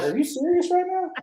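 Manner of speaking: incredulous. Retold or imitated. Retold shock at bad cake.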